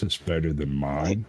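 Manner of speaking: ordinary delivery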